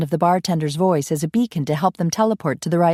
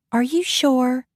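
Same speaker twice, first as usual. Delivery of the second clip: much higher Southern belle doubt; doubtful belle; 8 semitones higher, slower than usual. Acted. Coralie doubting Ian, high belle. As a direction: question him like a puzzled belle.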